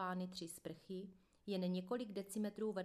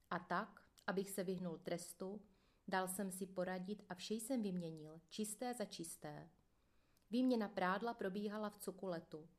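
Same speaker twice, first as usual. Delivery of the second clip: plain reading voice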